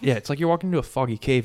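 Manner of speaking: normal talking voice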